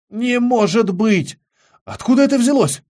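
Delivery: high-pitched, stretched-out gasp of disbelief; astonished disbelief. A narrator's reading. Boy sees the last jar in the museum tent, astonished. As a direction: blurt it high, incredulous.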